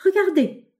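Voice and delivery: high, loud call to look; excited cry. A narrator's reading. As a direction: cry out, pointing it out. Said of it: "s'écria-t-il soudain".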